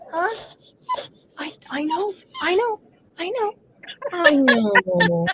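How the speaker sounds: high sympathetic coo; soothing pet-talk. Real, not acted. Soothing her dog.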